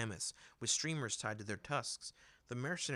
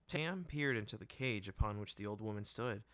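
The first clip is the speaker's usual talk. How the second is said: usual speaking voice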